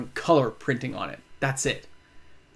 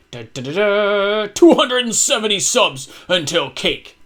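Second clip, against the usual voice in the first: slow, louder drumroll announcement; fanfare reveal; 2 semitones higher, 4 dB louder, slower than usual. Acted. Revealing the subscriber goal to viewers, slow louder fanfare. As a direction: build it up like a big reveal. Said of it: "You ready?".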